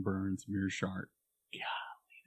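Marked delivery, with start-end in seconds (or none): 1.5-2.2 breathy whispered golly under the breath. Real, not acted. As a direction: let it slip as a soft whisper. Flustered aside after tongue-tied name.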